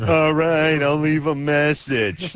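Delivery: slow, droning imitation of a dull voice; lightly mocking a monotone guy; mimicking Adam. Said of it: "people mimic me back to me".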